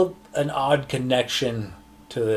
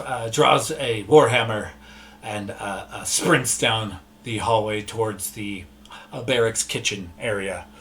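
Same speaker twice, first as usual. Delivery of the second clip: ordinary delivery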